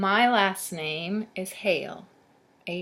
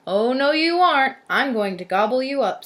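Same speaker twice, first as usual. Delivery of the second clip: high, very loud troll threat; loud storybook troll pouncing; menacing troll; 7 semitones higher, 10 dB louder than usual. A narrator's reading. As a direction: swell into a hungry monster's bellow. Troll threatens to eat goat.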